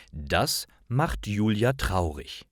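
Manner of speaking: ordinary talk, nothing marked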